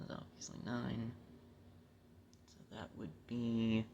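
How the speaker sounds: very soft, halting mental math; muttering to himself. Real, not acted. Working out the inches in his head, muttered. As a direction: work it out under your breath, slowly.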